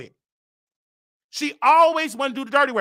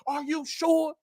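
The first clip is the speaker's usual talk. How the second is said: out of breath; 5 semitones higher, 6 dB quieter, slower than usual. Retold or imitated. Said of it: "She was out of breath".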